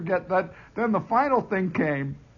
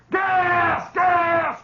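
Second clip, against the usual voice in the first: high, loud yell of gas; hollered alarm; 13 semitones higher, 4 dB louder than usual. Retold or imitated. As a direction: bellow the warning at the troops. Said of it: "he hollers".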